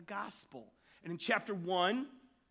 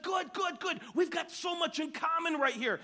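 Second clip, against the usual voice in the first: high, gushing good good; thrilled at finding a fellow Baptist; delighted preacher; 10 semitones higher, 3 dB louder than usual. Retold or imitated. Preacher delighted with the jumper, high and gushing. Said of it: "And the preacher said".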